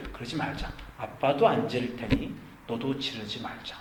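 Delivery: hushed, low reasoning after the outburst; calm fatherly pleading. Retold or imitated. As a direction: coax the child softly. Father calmly reasons with shouting son.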